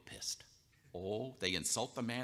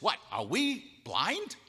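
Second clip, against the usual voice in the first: incredulous; 9 semitones higher, 5 dB louder, much slower than usual. Acted. Pharisees' incredulous question.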